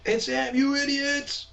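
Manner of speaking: high-pitched imitation of exasperated listeners; mock yelling. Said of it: "yelling out his name".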